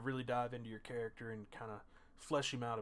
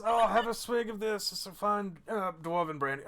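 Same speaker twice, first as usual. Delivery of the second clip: slow, high, tipsy offer; drunk dwarf; 7 semitones higher, 7 dB louder than usual. Acted. Dwarf offering the princess a swig of brandy, drunken cheer. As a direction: push the drink on them with boozy enthusiasm. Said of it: "He says".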